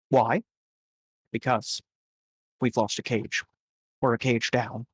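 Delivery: quiet, measured briefing to the crew; boss NPC. Acted.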